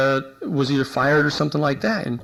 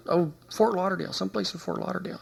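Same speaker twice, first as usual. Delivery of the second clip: fading from soft to near-silent mumble; reluctant mumble; 4 semitones higher, 7 dB quieter than usual. Retold or imitated. Cop reluctantly naming place to investigator, fading mumble. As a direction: let it trail away, reluctant to say.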